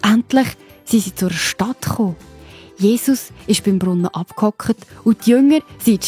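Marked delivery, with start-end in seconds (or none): none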